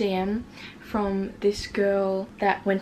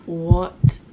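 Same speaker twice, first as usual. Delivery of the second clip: slow, loud, word-by-word disbelief; retold shock; 3 semitones lower, 7 dB louder, much slower than usual. Vlogger relives being called out by the scammer, slow loud shock. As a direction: land each word heavily and slowly. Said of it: "And I was like".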